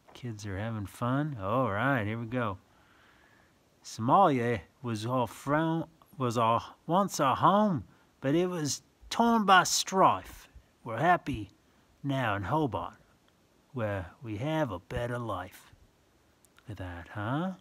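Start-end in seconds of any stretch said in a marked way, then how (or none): 3.8-15.7 sing-song rhyming read-aloud; reading verse to children. Acted. Reading a picture book to a child, sing-song. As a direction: lilt the rhyme for little listeners.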